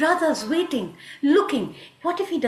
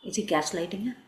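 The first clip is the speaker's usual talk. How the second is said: plain reading voice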